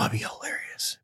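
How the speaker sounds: whispering. Real, not acted. Plotting to mute Dave's mic.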